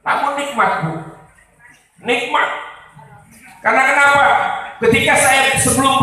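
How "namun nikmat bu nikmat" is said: ordinary talk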